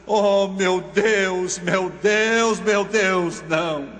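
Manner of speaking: slow, drawn-out wail imitating a sobbing boy; acted anguish, stretching out each cry to God; anguished sobbing plea.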